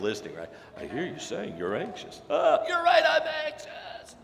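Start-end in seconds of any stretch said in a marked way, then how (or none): 0.8-2.2 soft, low, soothing reflective listening; calmly mirror it back, counselor-soft; mock counselor. Preacher mimicking a listener reflecting back, mock soothing. 2.3-4.1 much higher, shouted and slow; mock anxious shout. Preacher acting the anxious person agreeing, mock frantic shout.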